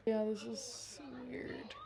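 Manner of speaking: hushed, slow aside; quiet awkward whisper while watching. Real, not acted. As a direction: whisper it like a secret. Hushed reaction to film.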